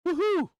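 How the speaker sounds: high-pitched celebratory woohoo; real gleeful cheer; excited whoop. Real, not acted. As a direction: whoop like you just got paid. Celebrates a trade paying off.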